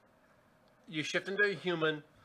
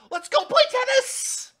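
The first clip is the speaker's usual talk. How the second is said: very high, loud sing-song call; gleeful Red Queen; 12 dB louder than usual. Acted. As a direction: call out delighted to go play. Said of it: "she starts sauntering off".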